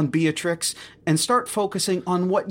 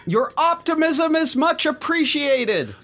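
much higher, slow, ceremonious; grand praise; 13 semitones higher, 4 dB louder, slower than usual. Acted. The manager lauds the injured worker, grandly. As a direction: bestow the praise like a proud manager.

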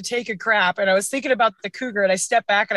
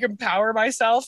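usual speaking voice

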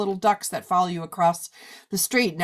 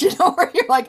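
laughing; 9 semitones higher, 5 dB louder, faster than usual. Real, not acted. Laughing at hot flash image.

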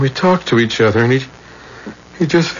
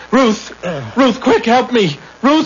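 panicked call for help; 9 semitones higher than usual. Acted. Calling wife for help.

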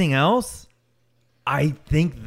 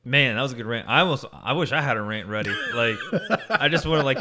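ordinary talk

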